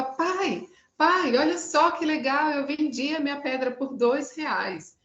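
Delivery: put-on high, eager child calling his father; bursting with pride over the sale; excited little boy. Boy runs home to tell his father, high excited voice. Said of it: "volta todo feliz pra casa e fala".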